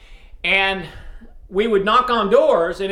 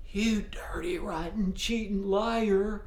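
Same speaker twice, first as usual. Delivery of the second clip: whispered, much softer; 9 dB quieter, slower than usual. Retold or imitated. Old man answering the sheriff's order in a whisper. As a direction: breathe the insult out without voice. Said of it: "it was almost a whisper".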